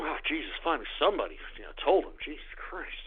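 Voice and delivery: fast, hushed muttered relief; a quiet, quick mock parent; mock relieved parent. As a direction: rattle it off under your breath. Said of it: "what mommy and daddy are gonna do".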